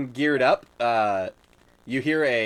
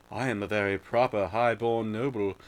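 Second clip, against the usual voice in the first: put-on posh English accent; 4 dB quieter than usual. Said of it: "the good guys are all like".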